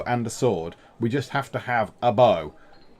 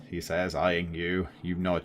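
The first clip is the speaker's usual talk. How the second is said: ordinary talk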